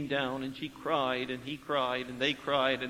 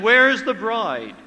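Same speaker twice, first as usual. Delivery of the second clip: raised, urgent question; raised voice asking where the bride is; urgent demand; 4 semitones higher, 7 dB louder, slower than usual. Retold or imitated. Priest demands in retold scene.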